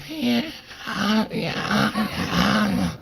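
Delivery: tiny, very high voice that has failed; little-girl voice. Retold or imitated. Defendant asked to speak before sentencing, voice gone, little-girl squeak. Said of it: "I was like a little girl".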